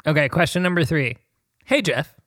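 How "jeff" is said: ordinary delivery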